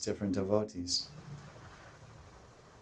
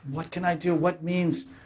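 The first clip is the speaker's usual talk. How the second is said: pleading lament; 7 semitones higher than usual. Acted. Radharani's lament voiced.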